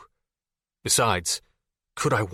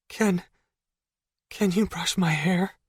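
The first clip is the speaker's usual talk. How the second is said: whispering, voice cracking; 9 semitones higher than usual. Acted. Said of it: "she whispered, her voice cracking".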